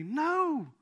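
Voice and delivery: usual speaking voice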